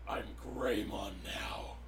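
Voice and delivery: low breathy monster whisper. Retold or imitated. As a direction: whisper it like a creature. Thought voiced as monster.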